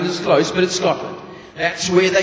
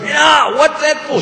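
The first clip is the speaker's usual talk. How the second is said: higher, louder outraged yelp; pained yell; 9 semitones higher, 6 dB louder, slower than usual. Acted. Husband hit by a pan, loud high outraged yell. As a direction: yell it like the pan just landed.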